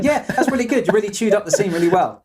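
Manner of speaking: high, gushing fake praise; mock complimenting. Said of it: "going around complimenting actors going".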